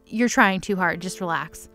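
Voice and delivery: ordinary talk, nothing marked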